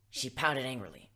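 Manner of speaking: plain reading voice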